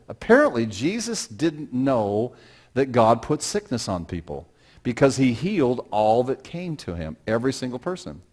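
ordinary talk

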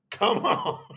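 breaking into a laugh; delighted chuckle after the words. Real, not acted. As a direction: let "come on" spill into laughter.

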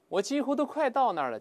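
normal talking voice

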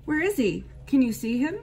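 high, loud frightened doe. A narrator's reading. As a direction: call out anxiously, searching the snow.

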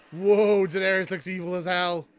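loud, awed outburst at the screen; excited whoa. Real, not acted. Fan stunned by Daenerys on screen, excited gasp. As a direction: burst out in amazement.

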